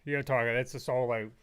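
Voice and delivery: normal talking voice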